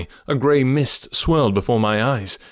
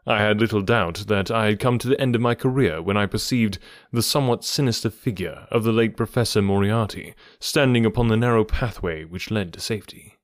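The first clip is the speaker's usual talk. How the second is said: ordinary delivery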